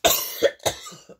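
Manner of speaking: rough hacking cough; coughing fit. Real, not acted. Reader choking during the reading, hacking cough. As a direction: cough hard, struggling to clear the throat. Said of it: "I gotta get".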